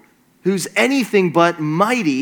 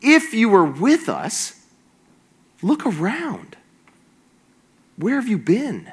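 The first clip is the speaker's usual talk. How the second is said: spaced-out, pointed sarcasm in a raised pitch; dripping sarcasm; 5 semitones higher than usual. Acted. Gideon doubting that God is with his people, sarcastic. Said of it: "the sarcasm dripping from his words".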